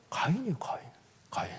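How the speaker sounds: hushed whispered answer; whispering the answer to the congregation. Real, not acted. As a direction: drop to a whisper.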